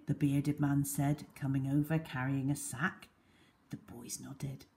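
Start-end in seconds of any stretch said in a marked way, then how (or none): none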